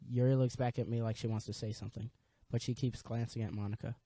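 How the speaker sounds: normal talking voice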